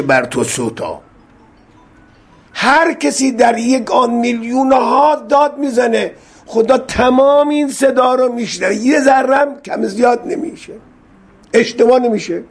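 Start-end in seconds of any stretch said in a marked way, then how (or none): 2.5-12.4 impassioned preaching. Real, not acted. Preacher's emotional climax on God hearing.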